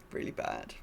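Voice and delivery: dropped to a whisper; whispered really bad; muttered aside. Real, not acted. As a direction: breathe it out as an aside. Muttered aside on weak content.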